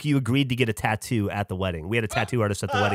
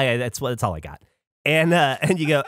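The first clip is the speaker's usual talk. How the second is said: ordinary talk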